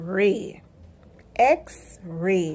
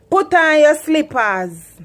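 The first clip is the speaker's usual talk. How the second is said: loud, higher Patois order, slow; Jamaican parent command; 5 semitones higher, 6 dB louder, slower than usual. Shown as a demonstration. Jamaican mother telling child to put slippers on. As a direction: snap it out like a Jamaican mum. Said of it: "Here in Jamaica, we say".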